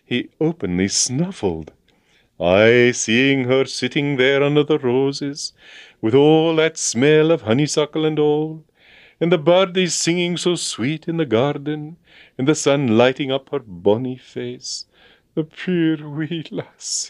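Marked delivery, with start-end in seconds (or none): 2.4-14.8 higher, sentimental Scottish lilt; Scottish accent. A narrator's reading. Agent reminiscing tearfully about the girl, Scottish sentimental. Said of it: "puir bonny wee lass". 15.3-17.1 sniffle it out in Scots; tearful Scottish mutter. A narrator's reading. Agent moved to tears over the girl, muttering Scots. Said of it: "he muttered".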